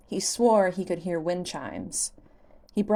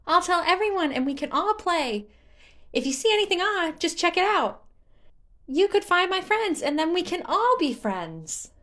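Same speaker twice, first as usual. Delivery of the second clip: high, loud, bubbly sprite voice; like a particularly enthusiastic child; 11 semitones higher, 4 dB louder than usual. Acted. Korok planning a game with Link, high bubbly voice.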